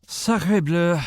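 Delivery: put-on professor's thick French accent; heavy French lilt on every word. Professor answers the colonel, French accent. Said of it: "I can't quite figure out your accent".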